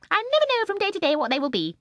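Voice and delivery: put-on high, loud, gleeful old woman; gleeful old lady. A narrator's reading. Mrs Corrie answering Michael, high gleeful old woman. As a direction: chirp it with glee.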